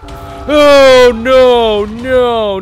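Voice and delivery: high, loud panicked pleading. Real, not acted. Player cornered by animatronic, crying out in panic. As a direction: dread rising as the threat closes in.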